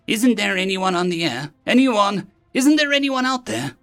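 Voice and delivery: high, mounting cry for anyone; frantic last radio call; desperate plea. Acted.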